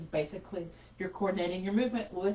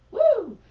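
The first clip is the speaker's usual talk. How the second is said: high loud whoop of effort; excited woo; 12 dB louder than usual. Real, not acted. Teacher whooping at the stretch, excited woo. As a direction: let out a bright whoop as the stretch bites.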